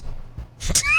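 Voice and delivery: a sudden high squeal of laughter. Real, not acted. Host cracking up at his own jab, high squealing laugh. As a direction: squeal with real amusement.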